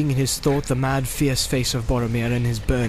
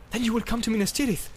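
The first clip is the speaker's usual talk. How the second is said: high, quick, eager question; excited Boromir, rushing the question; eager cry; 9 semitones higher, faster than usual. A narrator's reading. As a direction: jump in fast with hope, pitched up. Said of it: "cried Boromir".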